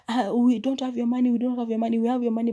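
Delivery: high, loud frantic begging, acted out; panicked pleading. Bola begs the gunman not to shoot her, high and frantic.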